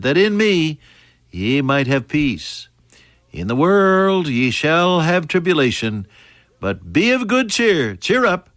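high-pitched, stretched preaching delivery; ringing scripture proclamation. Acted. Preacher quotes Jesus' promise to congregation, ringing proclamation.

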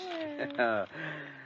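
delighted chuckle; happy laugh. Acted. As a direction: laugh with relief. Delighted after telegram.

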